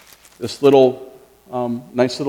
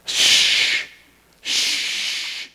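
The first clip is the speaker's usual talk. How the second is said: imitating a librarian's shush. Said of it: "you have been reminded a few times".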